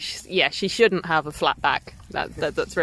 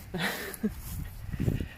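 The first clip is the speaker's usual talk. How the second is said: soft breathy laugh; 2 semitones higher, 10 dB quieter than usual. Real, not acted. Laughing about the cake.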